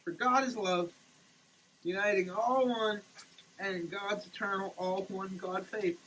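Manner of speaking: ordinary delivery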